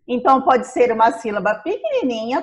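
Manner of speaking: ordinary talk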